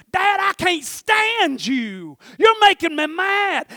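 high, loud, drawn-out retold outburst; hollering angry kid, imitated. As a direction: yell it like a furious child, stretching the words. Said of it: "I've had my kids go".

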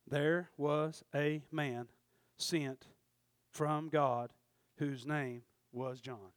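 slow, low, spaced-out scripture; deliberate verse reading. Acted. Preacher giving the simple biblical answer, deliberate verse reading. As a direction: lay it out softly, phrase by phrase.

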